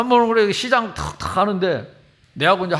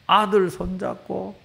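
ordinary talk, nothing marked

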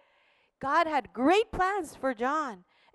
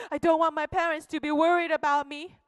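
normal talking voice

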